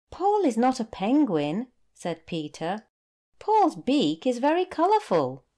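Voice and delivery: put-on a small boy explaining again; boy correcting.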